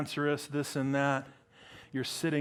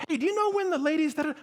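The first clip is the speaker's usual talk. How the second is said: high, rushed mock questioner; pestering church member; 12 semitones higher, much faster than usual. Church people come up to the pastor, pestering. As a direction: rattle it off high like an eager parishioner.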